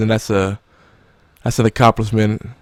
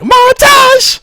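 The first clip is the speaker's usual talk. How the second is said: high squeal on montage; 7 dB louder than usual. Pretend. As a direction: squeal the word with glee. Mocking the review phrase.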